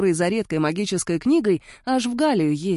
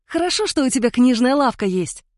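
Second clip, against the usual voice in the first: joyful; 6 semitones higher, 4 dB louder than usual. A narrator's reading. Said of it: "радостно изрекла Витка".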